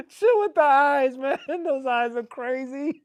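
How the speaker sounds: high, laughing "chill with the eyes"; laughing repeat. Retold or imitated. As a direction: say it again through laughter.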